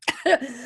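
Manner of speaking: short real cough; throat-clearing cough; coughing fit. Real, not acted. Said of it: "hold on a second".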